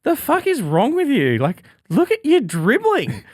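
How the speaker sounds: high, incredulous mock scolding; imitating April. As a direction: play April aghast at him. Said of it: "April walks in and goes".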